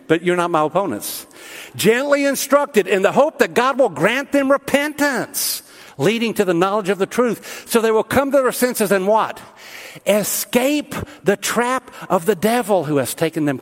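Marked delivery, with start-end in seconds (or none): 1.7-13.6 high, ringing scripture delivery; emphatic preaching. Real, not acted. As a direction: proclaim the verse to the congregation, pitch lifted.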